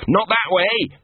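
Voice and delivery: high pleading cry; whined. Acted. Gaspode to Angua while fleeing, high whine. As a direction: yelp it in panic. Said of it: "whined Gaspode".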